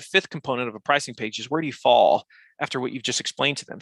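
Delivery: usual speaking voice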